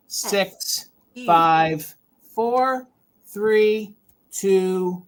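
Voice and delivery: steady, paced countdown calling out each number; live auction host counting down. Acted. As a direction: count the bids down one by one.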